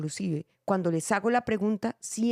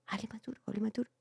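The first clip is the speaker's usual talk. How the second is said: soft whispered urging, much softer; whispered prompting; 8 dB quieter, much faster than usual. Retold or imitated. Castmates urging the actor, a soft whispered prompt. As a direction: hiss the cue under your breath.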